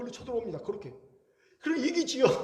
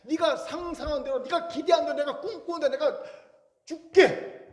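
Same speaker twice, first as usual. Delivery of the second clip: God promising big; 6 semitones higher, 4 dB louder than usual. Retold or imitated. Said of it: "하나님 열린 결말을 허락하시거든요".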